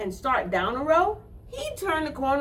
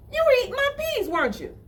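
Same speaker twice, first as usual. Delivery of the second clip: much higher scolding question; high little girl scolding; accusing girl; 14 semitones higher, faster than usual. Acted. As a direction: catch him out. Girl accusing caught rabbit.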